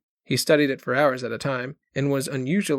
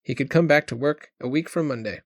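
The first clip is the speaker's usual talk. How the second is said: plain reading voice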